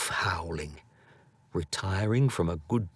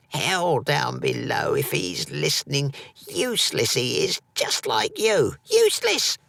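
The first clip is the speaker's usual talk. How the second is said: put-on much higher, harsh old mother's cry; exclaimed; 6 semitones higher than usual. A narrator's reading. Angry mother shouting at her son about his father. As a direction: spit it at him like a bitter crone.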